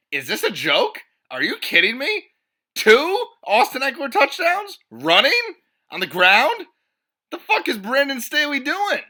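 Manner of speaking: hammed-up outrage at each touchdown; incredulous rant. Ranting about surprise touchdowns.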